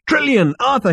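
calling out names, high and loud; excited shout. A narrator's reading. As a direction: shout to rouse your friends. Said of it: "he shouted".

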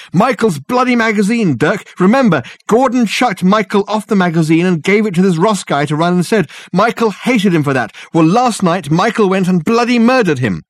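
high, loud agitated rush; frantic. A narrator's reading. Richard explaining the murder to Dirk, frantic and loud. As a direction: panic spilling out in a torrent.

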